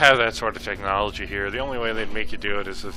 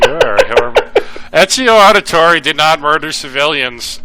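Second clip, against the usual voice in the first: mock stern scolding; 5 semitones higher, 11 dB louder than usual.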